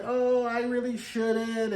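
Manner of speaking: high, slow, put-on hesitation; mock reluctance. Guilt-tripped man at the door, mock reluctant.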